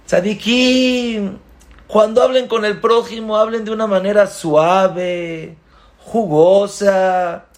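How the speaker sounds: rally the tzadikim; exhorting rabbi. Acted. Rabbi exhorts righteous students.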